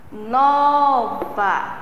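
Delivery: "Nova" with NO held long; stressed syllable drawn out. Shown as a demonstration. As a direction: linger on the first syllable of "Nova". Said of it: "a sílaba que eu demoro mais a".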